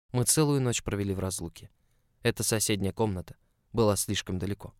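plain reading voice

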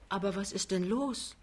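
soft, bewildered question; gentle puzzlement; baffled. A narrator's reading. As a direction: ask quietly, taken aback. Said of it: "fragt Lämmchen verblüfft".

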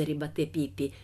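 plain reading voice

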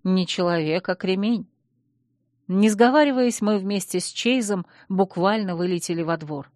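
usual speaking voice

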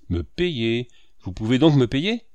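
stern questioning, rising a little. A narrator's reading. Landlord questions the painter's offer to pay, sternly. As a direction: question him severely. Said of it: "demanda sévèrement monsieur Lavoine".

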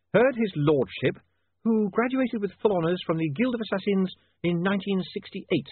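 high voice reciting a fact across the room; Saccharissa calling out. Acted. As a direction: call out the detail for dictation.

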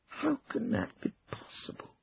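high-pitched, incredulous demand; demanding disbelief. Acted. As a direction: demand an explanation from the lawyers. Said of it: "demanded Ruth".

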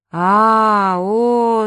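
long, loud drawn-out "ah, oh" moan; awed group groan.

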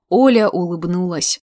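usual speaking voice

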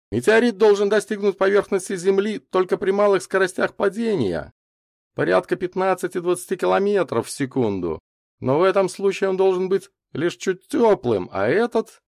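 high, sustained explanatory voice; puzzled professor. A narrator's reading. Лазарев explains meteorite physics to witnesses, puzzled. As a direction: lay out the theory, then leave it hanging.

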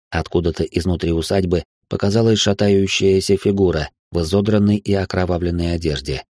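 ordinary delivery

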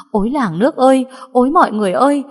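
joyful shout. A narrator's reading. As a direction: shout the good news to the whole hallway. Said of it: "rồi hét lớn".

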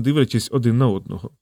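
ordinary delivery, nothing marked

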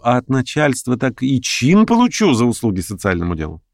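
lightly voiced orator, pitch often lifted; lame debater. Lame man weighs propaganda against quick action, pointed. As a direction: hold forth with deliberate emphasis, lingering on key words.

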